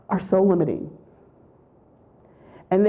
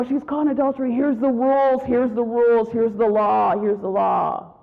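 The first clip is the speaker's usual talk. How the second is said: raised, chanting litany of accusation; insistent accusers; 4 semitones higher than usual. Retold or imitated. Crowd citing the law to Jesus, insistent accusing. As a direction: start high and step down with each repeat.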